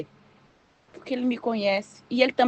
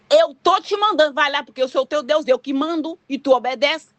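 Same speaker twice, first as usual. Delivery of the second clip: loud, raised imperious order; harsh commanding God; 6 semitones higher, 4 dB louder than usual. Acted. God ordering the believer to obey, harsh command. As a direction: lay down the law as the boss. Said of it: "Ele não fala assim, não".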